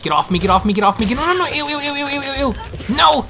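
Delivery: frantic get-off-me screams; panicked yelling. Real, not acted. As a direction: shriek at the zombie to let go. Panicked when grabbed by zombie.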